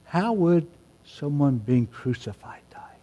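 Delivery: breathy whispered question; hushed whisper. Acted. Preacher whispering the question to the congregation. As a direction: drop to a whisper to draw them in.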